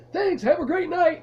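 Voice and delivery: chipper and happy. Retold or imitated. Clerk greeting the scared customer, chipper and happy. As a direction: sing it out with a big smile. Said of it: "chipper and happy and big smile".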